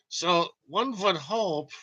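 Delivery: a light Einstein impression, slow. To viewers, a light Einstein German voice. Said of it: "let's do it in the Einstein voice".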